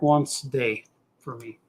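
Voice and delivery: normal talking voice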